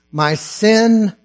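loud, high, slow declaiming that swells into a shout of praise; proclaimed hymn lines. Acted. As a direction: cry the hymn out as a triumph.

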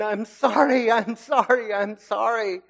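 high, loud mock-weeping apology; hammed-up crying plea, pitched high; retold tearful pleading. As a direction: imitate a child begging desperately through tears. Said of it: "crying and weeping".

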